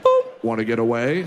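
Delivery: low, mock ad voice with a boop; airline ad jingle. Spoofing the airline ad in a low mock voice. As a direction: spoof the Southwest ad tagline. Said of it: "the Southwest airline logo".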